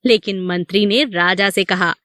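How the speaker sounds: usual speaking voice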